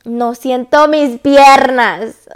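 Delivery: loud, slow, drawn-out complaint; exaggerated exclamation, each word stretched; retold loud protest. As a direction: wail it out slowly, acting out the moment. Retells her panicked protest.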